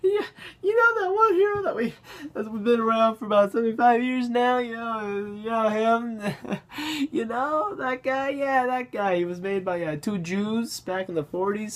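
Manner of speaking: high-pitched, strained stoner voice; squeaky, giggly pothead impression; stoned writer. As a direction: talk as if holding in smoke. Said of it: "smoking weed or something like".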